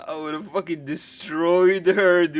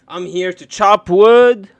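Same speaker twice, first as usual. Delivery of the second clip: mock manly boast; 11 dB louder, slower than usual.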